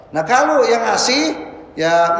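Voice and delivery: ordinary delivery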